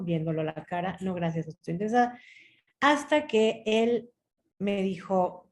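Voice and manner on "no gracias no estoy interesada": quick, even refusal; same unchanging tone. Retold or imitated. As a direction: repeat it rapidly without rising to the bait. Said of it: "con el mismo tono".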